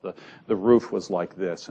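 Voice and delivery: ordinary talk, nothing marked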